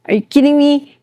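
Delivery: ordinary talk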